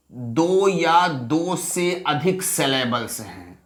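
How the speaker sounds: slow, measured stress on "दो या दो से अधिक"; emphatic reminder. Shown as a demonstration. Teacher tells class to remember this rule, slow and emphatic. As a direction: spell out "दो या दो से अधिक" slowly, word by word. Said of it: "याद रखिएगा".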